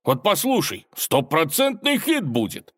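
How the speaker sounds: high, proud announcement; eager boast. A narrator's reading. Robber about to play for the tsar, eager. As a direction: promote your song like a sure hit.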